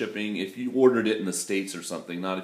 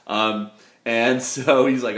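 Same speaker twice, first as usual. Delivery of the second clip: normal talking voice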